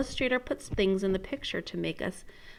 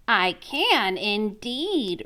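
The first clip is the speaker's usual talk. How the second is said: friendly frog; much slower than usual. Acted. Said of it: "replied the friendly frog".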